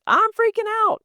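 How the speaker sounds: very high, loud panicked squeal; mock panic. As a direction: squeal it like a brain in sudden alarm. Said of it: "all of a sudden her brain goes".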